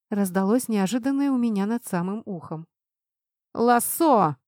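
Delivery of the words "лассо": moderately raised urgent call; contained narrator's shout. A narrator's reading. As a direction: call out a command in the heat of a fight. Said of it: "кричал Грег".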